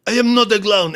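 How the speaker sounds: high, offended mock protest; mock indignant. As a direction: play an insulted clown. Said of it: "And it's like".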